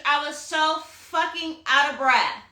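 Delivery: ordinary delivery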